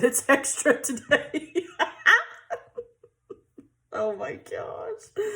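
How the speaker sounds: high burst of laughter then slow oh my gosh; giggling at herself; laughing. Real, not acted. Laughing at scatterbrained self.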